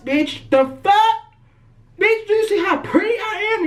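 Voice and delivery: shrieking high, indignant and incredulous; outraged disbelief. Retold or imitated. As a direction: squeal it out like you can't believe the nerve. Outraged at being tackled.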